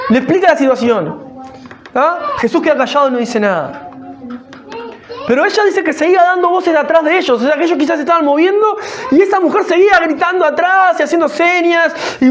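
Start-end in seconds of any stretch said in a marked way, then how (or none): none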